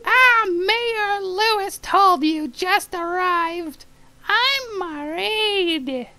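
very high, very slow, loud falsetto; squeaky falsetto villager. Acted. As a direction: drag it out in a shrill squeaky woman's voice. Voicing a woman villager.

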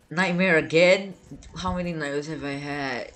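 ordinary delivery

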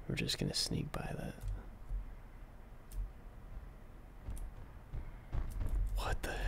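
hushed whisper, sneaking past. Real, not acted. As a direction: breathe the words so nothing hears.

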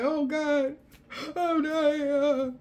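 high, hammed-up cries of alarm; mock panic. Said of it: "And then it's like".

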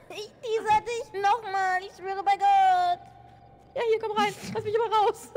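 frantic yelling. Real, not acted. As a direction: yell in real panic. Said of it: "Tut mir leid fürs Rumgeschrei".

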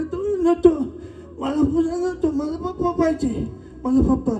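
put-on very high, tearful child's wail; crying little boy. Lost boy refuses the balloon, high acted crying. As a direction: refuse tearfully, wanting only Papa. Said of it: "तो रडू लागला".

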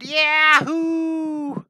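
high, loud whooping yell; screamed cheer. Retold or imitated. Artist retelling his wild dolphin ride, screamed whoop. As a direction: let out a long, exhilarated whoop. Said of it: "I screamed".

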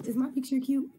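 ordinary delivery

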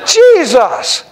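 laughing Jesus!; laughing exclamation. Real, not acted. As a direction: burst out the name in delight. Ecstatic exclamation while laughing.

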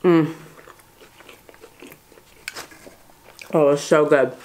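chewing while talking; eating a burger; mouth full. Real, not acted. Seen 0: Bites burger just before; closed mouth, moving, burger held throughout.

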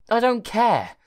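hammed-up arrogant kid. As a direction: sneer at the computer's advice. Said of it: "he replied arrogantly".

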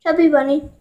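talking through stuffed cheeks; mouth full. Real, not acted. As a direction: say it round a mouthful of marshmallows. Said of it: "we're each gonna have a marshmallow in our mouth".

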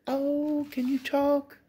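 very high, excited coo; delighted pet talk. Real, not acted. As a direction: squeal with delight at the dog. Delighted at the dog.

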